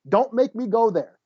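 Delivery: usual speaking voice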